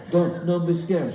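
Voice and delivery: put-on slow, hypnotizing Italian-accented reassurance; tranquil Italian accent. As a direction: lull her slowly in an Italian accent. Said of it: "It was, like, tranquil".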